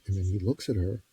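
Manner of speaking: ordinary talk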